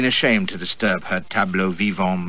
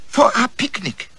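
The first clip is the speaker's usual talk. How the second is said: much higher, slow and thrilled; shrill with excitement; 13 semitones higher than usual. A narrator's reading. Girl showing off the yacht, high with excitement. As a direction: squeal it out, high and delighted. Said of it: "her voice was shrill with excitement".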